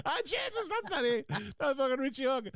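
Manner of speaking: high-pitched, laughing mock alarm; retold startled realisation. Retelling waking up confused, laughing.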